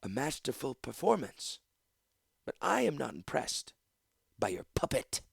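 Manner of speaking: icy disdain with measured pauses; cold. A narrator's reading. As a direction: dismiss it coldly for close ears only. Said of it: "Gorsha said coldly".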